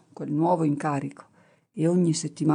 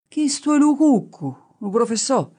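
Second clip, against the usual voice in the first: higher and louder rustic dialect answer; insistent shepherd; 8 semitones higher, 4 dB louder than usual. Acted. Shepherd repeating his answer to the professor, louder and insistent. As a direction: insist on the same wrong answer, bold and loud.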